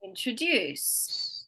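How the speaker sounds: very slow "introduce", long DUCE; stress on last syllable. Shown as a demonstration. Teacher modelling for repetition, drawn-out in-tro-DUCE for "introduce". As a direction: stretch "introduce" toward a strong final syllable. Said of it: "tell me where I'm emphasizing".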